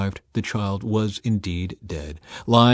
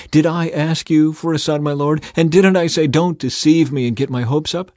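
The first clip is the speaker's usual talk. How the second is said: higher, louder indignant challenge; reproachful; 7 semitones higher, 5 dB louder than usual. A narrator's reading. Bereaved mother to the man of God, in reproach. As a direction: confront him with raised, accusing questions.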